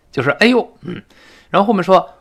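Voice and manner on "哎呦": startled ouch. Acted. Translating a startled "Yikes.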